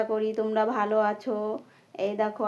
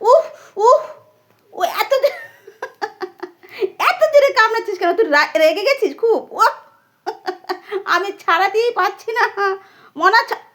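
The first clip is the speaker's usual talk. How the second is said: higher and louder, real ouch while scolding; pained yelp; 7 semitones higher, 5 dB louder than usual. Real, not acted. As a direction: yelp as the beak digs in. Parrot biting hard, pained cries.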